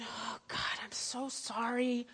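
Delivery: hushed, near-whispered apology; soft contrite prayer; remorseful hush. Retold or imitated. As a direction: breathe out a sorry confession. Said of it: "you're going".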